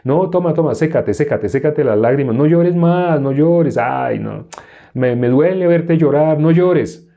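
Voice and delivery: hammed-up comfort with stretched endings; mock religious consoling. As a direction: parody a fussing, pitying believer. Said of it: "Una persona religiosa".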